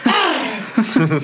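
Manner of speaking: a short, high, hammed-up grunt of effort; a lazy fighter's mock groan; a hammed-up grunt from a lazy fight scene. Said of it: "This is me elbowing backwards".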